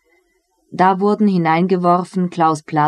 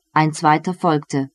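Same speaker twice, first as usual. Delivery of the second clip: usual speaking voice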